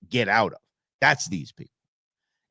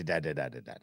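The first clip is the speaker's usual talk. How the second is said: normal talking voice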